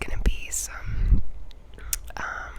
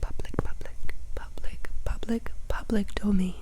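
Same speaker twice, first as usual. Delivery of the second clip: asmr trigger words. Acted.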